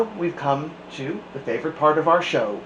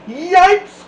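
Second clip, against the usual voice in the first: high, loud startled yelp; frightened cry; 11 dB louder than usual. A narrator's reading. Kids crying out in fright at the substitute. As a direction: shriek in alarm at the witch. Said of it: "cried the kids".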